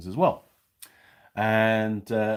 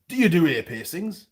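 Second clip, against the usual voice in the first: bold, raised pitch and volume; brazen; 9 semitones higher, 7 dB louder than usual. Retold or imitated. Customer asking the pharmacy lady at the counter, brazen. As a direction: ask it brazenly, loud and high. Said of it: "You know, quite brazenly".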